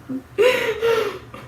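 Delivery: squealing laugh; high burst of laughter. Real, not acted. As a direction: let out a high giggle. Laughing at her own joke.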